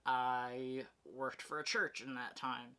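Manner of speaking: ordinary talk, nothing marked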